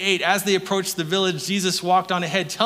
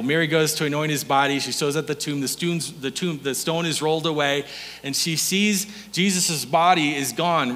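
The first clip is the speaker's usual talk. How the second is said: normal talking voice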